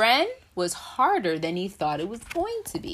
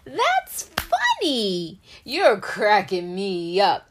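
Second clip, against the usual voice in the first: laughing egg; 3 semitones higher, 6 dB louder, slower than usual. Acted. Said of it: "Egg laughed".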